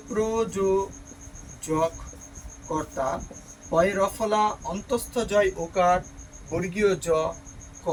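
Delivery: প্রযোজক কর্তা very slowly, piece by piece; spelling it out. Shown as a demonstration. Teacher dictating a spelling to students, slow and syllable by syllable. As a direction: draw out each syllable of প্রযোজক, pausing before কর্তা. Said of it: "বানানটি হচ্ছে".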